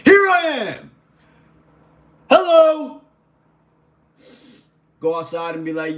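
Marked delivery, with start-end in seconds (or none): 0.0-3.0 very high, loud announcing yell; bold shout. Acted. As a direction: call it out to the whole room. Acts out walking boldly into a room.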